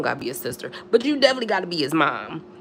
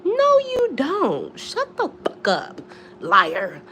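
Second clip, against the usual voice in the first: mock outraged yell; 7 semitones higher, slower than usual. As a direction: throw it back at a lying man, mockingly. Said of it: "And I'm like".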